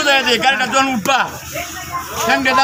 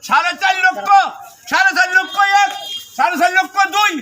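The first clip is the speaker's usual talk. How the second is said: auctioneer; 5 semitones higher than usual. Acted. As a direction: call out the bids like an auctioneer. Auctioneer repeats bid.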